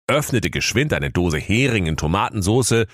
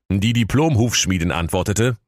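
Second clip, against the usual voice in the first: ordinary delivery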